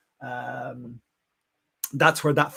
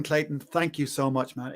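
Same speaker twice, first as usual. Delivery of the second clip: ordinary delivery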